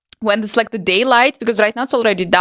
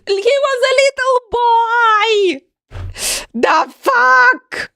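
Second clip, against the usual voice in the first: high, crying-out disbelief; shocked; 12 semitones higher, much slower than usual. Real, not acted. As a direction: yelp in total shock. Shocked relative now turns eighteen.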